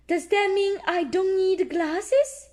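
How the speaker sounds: high hopeful little pig; Peppa voice. Acted. Peppa asking the doctor about glasses, high voice. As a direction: ask it brightly as Peppa.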